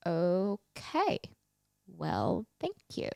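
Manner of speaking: soft, halting mock politeness; hesitant, doubtful. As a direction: trail through it quietly, unconvinced. Retold doubtful reply to the doctor.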